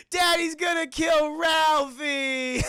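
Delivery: high, loud, slow bawl; hammed-up terrified child; wailing little kid. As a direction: sob it out, stretching each word. Little brother wailing in cupboard.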